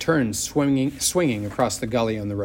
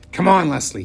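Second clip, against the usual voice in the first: louder, a bit higher, insistent; firm call; 5 semitones higher, 6 dB louder than usual. A narrator's reading. Boy urging friend away from bully, firm. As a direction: push past your fear.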